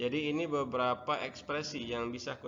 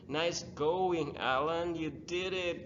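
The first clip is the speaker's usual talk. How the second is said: higher, louder, lively intonation; expressive praise; 5 semitones higher, 5 dB louder, much slower than usual. Shown as a demonstration. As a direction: cheer it with feeling, not flat. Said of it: "jangan datar aja".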